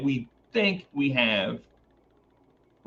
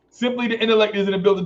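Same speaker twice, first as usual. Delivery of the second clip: much higher, fast, affected voice through a laugh; high put-on voice, laughing; 7 semitones higher, much faster than usual. Streamer laughing at his own catchphrase in a high affected voice. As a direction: rush the boast out in a lofty squeak.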